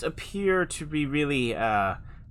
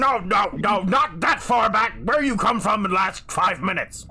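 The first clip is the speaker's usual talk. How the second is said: loud, high-pitched orc interruption; exasperated orc; 7 semitones higher, 5 dB louder than usual. Acted. Orc stops a prisoner's rambling story, exasperated shout. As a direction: cut him off with a raised, impatient voice.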